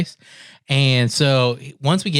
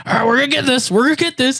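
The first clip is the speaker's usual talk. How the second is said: much higher, urging voice; imitated struggling officer; 11 semitones higher, faster than usual. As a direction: mimic someone wrestling with a stuck seatbelt. Officer struggling with seatbelt over him.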